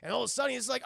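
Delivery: ordinary delivery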